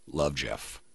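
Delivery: ordinary talk, nothing marked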